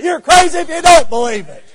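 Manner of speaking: high, loud retort from the pulpit; emphatic retort. Real, not acted. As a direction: throw it right back at the doubter. Preacher retorts to doubter.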